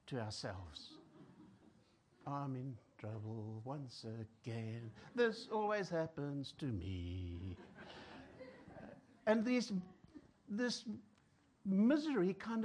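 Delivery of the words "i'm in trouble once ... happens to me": slow, low, soft self-pitying lament; self-pitying moan. Acted. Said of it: "tunes that we can sing in the misery".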